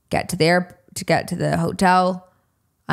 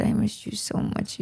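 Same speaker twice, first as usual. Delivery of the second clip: whispered; 3 semitones higher, 8 dB quieter than usual. Retold or imitated. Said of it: "I said".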